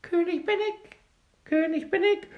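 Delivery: very high little bird voice; fine tiny voice. A narrator's reading. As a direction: pipe it high from the mousehole. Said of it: "mit seiner feinen Stimme".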